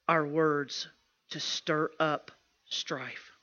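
normal talking voice

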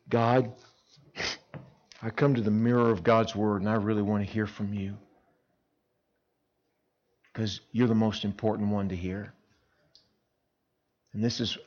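soft, hushed earnest prayer; sincere prayer. Acted. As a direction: pray like you mean it quietly.

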